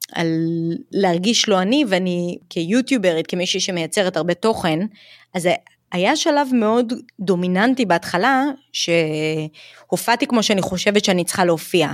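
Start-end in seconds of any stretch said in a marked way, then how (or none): none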